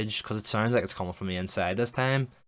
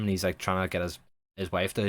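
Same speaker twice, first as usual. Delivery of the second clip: usual speaking voice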